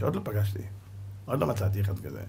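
normal talking voice

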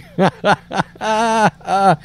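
trailing chuckle; amused laugh. Real, not acted. Host reacting to the actor's "all day" joke, amused chuckle. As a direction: laugh heartily at the joke just read. Amused by the quote just read.

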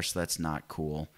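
usual speaking voice

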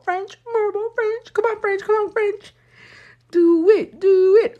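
root hard for French to win; high-pitched pleading cheer. Real, not acted.

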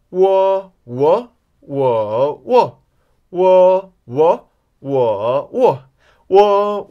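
loudly cycle 我/喔 through four Mandarin tones; four-tone drill. Shown as a demonstration. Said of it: "Are you ready for an exercise".

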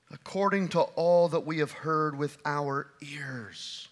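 hushed whisper of the verse; whispered scripture. Acted. Preacher whispering the verse to the congregation.

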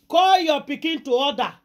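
warning command. Retold or imitated. Seer warns father.

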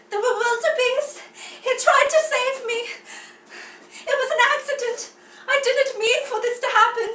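high, loud, fast protest; overacted frantic child; panicked young cub. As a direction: plead your innocence in a rush. Acted cub pleads innocence to villain.

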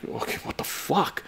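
whispered, fed-up ticket lady; exasperated whisper. Retold or imitated. Ticket lady caving to mom, a defeated whisper. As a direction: breathe it out, giving in. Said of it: "the lady's like".

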